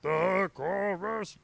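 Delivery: high, very drawn-out mock shout; mock chorus shout. Co-host mocking the singer's chorus style, mock shouting. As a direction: parody a rock singer hollering, very slowly. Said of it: "just kind of shouting it at you".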